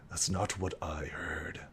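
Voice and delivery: low and hushed; low, wary reply. Acted. Guard doubts that the dead can't talk, low and quiet. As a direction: answer quietly, deep in the voice. NPC doubts the visitors are living.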